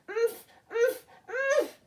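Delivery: high muffled grunts through a gag; gagged grunts. Acted. Gagged little sister trying to warn mother, muffled high grunts. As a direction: grunt urgently, mouth stuffed. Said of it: "took the sock out of her mouth".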